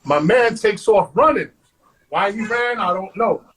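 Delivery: ordinary talk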